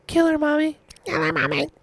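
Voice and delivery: put-on high, eerie little-kid voice; creepy small child pleading with mother; child's voice. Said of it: "she says in a child's voice".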